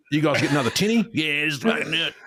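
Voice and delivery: Australian accent, hammed up; mock Australian bloke. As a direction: egg on a mate to grab a beer, Australian accent.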